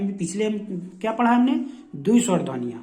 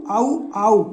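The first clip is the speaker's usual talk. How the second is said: औ called out louder and held; emphatic vowel; 2 semitones higher, 5 dB louder than usual. Shown as a demonstration. Teacher sounding out औ for students. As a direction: hit the औ sound firmly. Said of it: "औ की ध्वनि प्रकट करता हो".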